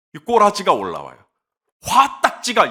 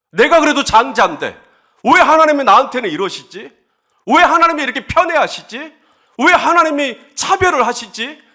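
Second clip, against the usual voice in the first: high, loud, aggrieved questioning; angry Cain; 6 semitones higher, 5 dB louder than usual. Acted. Preacher voicing the firstborn accusing God of favouritism, angrily. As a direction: protest the unfairness with rising indignation. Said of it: "계속 화가 나는 거예요".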